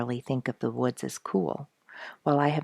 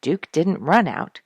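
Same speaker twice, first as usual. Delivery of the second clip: ordinary delivery, nothing marked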